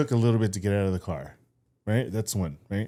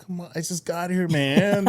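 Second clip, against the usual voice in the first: higher-pitched retold plea; mock whining complaint; 7 semitones higher than usual. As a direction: moan it as if to yourself. Retells his inward complaint.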